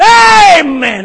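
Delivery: high, loud joyful amen cry; mock shouted amen. Pastor imitating the amen he never hears, loud and high. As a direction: belt out an amen like an eager churchgoer. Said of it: "I don't see anybody going".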